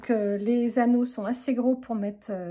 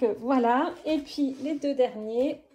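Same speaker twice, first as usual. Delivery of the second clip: ordinary talk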